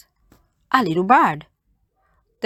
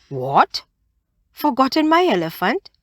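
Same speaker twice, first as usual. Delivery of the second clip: shocked Anansi; 7 semitones higher than usual. A narrator's reading. Anansi realises his mistake.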